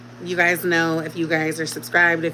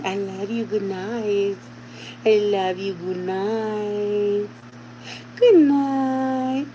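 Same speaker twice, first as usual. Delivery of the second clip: drawn-out sing-song goodnight to a little one; Miss Rachel; 3 semitones higher, much slower than usual. Real, not acted. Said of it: "talking in the Miss Rachel voice".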